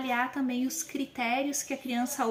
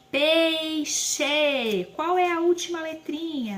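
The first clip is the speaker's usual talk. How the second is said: higher, louder quizzing tone; teacher voice to a child; 4 semitones higher, 3 dB louder, slower than usual. Shown as a demonstration. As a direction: prompt the child for the last letter. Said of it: "o som final".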